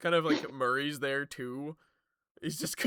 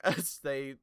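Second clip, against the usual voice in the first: ordinary delivery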